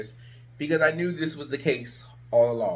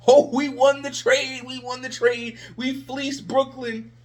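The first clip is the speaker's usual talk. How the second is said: higher mock triumph, dripping irony; sarcastic gloat; 8 semitones higher, 3 dB louder than usual. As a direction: gloat sarcastically over the trade.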